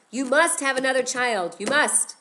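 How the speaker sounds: urgent insistence. Acted. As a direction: Miriam begging her mother, loud and pressing. Miriam urging her mother again.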